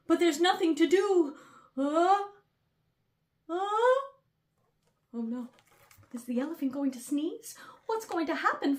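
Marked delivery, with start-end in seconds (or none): none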